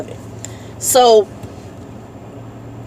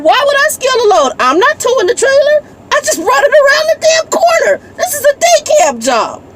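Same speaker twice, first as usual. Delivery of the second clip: retold outburst. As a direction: vent it up high at the other driver, voice cracking.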